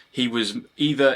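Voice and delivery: usual speaking voice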